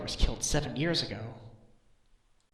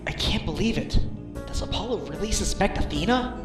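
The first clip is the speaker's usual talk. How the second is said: higher, incredulous questioning; dismayed disbelief; 6 semitones higher than usual. Acted. Reacting to Apollo suspecting Athena, dismayed disbelief. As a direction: wonder aloud, unable to accept it.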